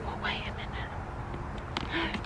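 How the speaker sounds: put-on high whispered baby talk.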